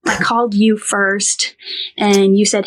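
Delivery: ordinary talk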